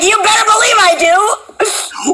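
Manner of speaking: high, slow, eager "you better believe"; eager mock reply. Host mimics the actor eagerly accepting the job. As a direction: play it overeager and proud. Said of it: "You're like".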